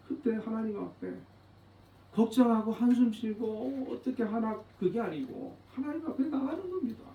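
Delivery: usual speaking voice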